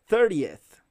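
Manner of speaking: "thirtieth" loud, with the final E and TH distinct; careful -eth ending. Shown as a demonstration. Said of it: "Cuidado aí na pronúncia".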